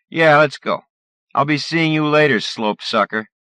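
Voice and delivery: loud, high parting jeer; sneering bully. Acted.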